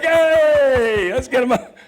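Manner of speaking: high, loud mock cheering. Read-through crowd cheering him out, high mock cheer. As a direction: cheer like a crowd glad it's over.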